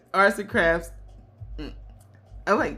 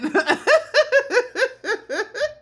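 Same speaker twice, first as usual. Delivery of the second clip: high laugh. Real, not acted. Laughing to viewers about businesses run into the ground. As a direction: laugh at your own past.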